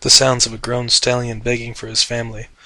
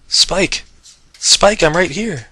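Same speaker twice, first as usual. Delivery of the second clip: raised call into the fog; 6 semitones higher, 4 dB louder, slower than usual. Acted. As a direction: call out to find him. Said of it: "she called".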